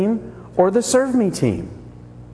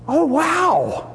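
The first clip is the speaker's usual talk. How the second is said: impressed wow; 8 semitones higher than usual. Retold or imitated. Sheep delighted at the news.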